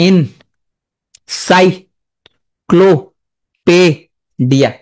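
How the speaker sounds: এন, সাই, ক্লো, পে, ডিয়া with long gaps; broken into syllables. Shown as a demonstration. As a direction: spell it out piece by piece for a class. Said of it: "যদি ভাঙো". (ভেঙে ভেঙে এন-সাই-ক্লো-পে-ডিয়া)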